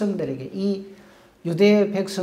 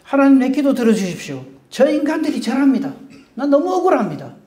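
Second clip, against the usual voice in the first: loud, high, whining complaint to God; mock aggrieved prayer; 6 semitones higher, 7 dB louder than usual. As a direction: cry out the grievance like a wronged believer. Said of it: "우린 그렇게 합니다".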